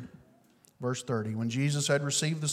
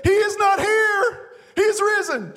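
joyful shout; 11 dB louder than usual. Retold or imitated. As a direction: cry it out stepping from the empty tomb. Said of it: "I said".

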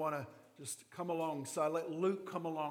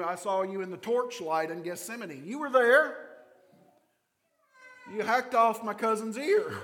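louder, higher accusation building; accusing relative; 3 semitones higher, 6 dB louder than usual. Retold or imitated. Malchus's relative accusing Peter by the fire, building to loud. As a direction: point him out, rising to a shout. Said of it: "says the third time".

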